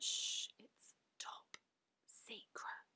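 hushed secret-sharing whisper; playful conspiratorial whisper. Pretend. Playfully sharing a secret club membership.

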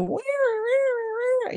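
put-on high whiny toddler voice; whiny child voice. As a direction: whine like a small child. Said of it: "that whiny voice that drives you crazy".

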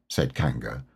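usual speaking voice

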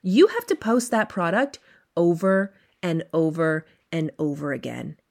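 ordinary talk, nothing marked

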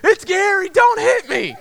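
loud, high-pitched panicked imitation; pleading buddy. Buddy pulling his mask off, imitated frantic plea. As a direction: yelp it out as a frightened prankster. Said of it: "and he's like".

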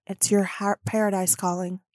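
ordinary delivery

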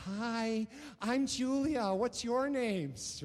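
put-on high, slow, sweet toddler introducing herself; little girl voice. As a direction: play a three-year-old saying hi to strangers.

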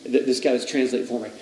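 ordinary talk, nothing marked

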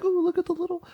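high, soft mock cooing voice. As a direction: coo like at a cute baby. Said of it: "I don't look at them like".

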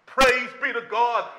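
ordinary talk, nothing marked

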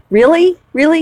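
high, loud disbelieving "Really? Really?"; hammed-up surprise at the price; incredulous. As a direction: pitch it way up in mock disbelief. Incredulous at tire speed rating.

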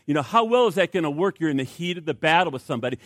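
ordinary delivery

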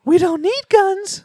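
put-on high, slow sinister kid voice; menacing little kid; creepy child voice. Voicing creepy kid again.

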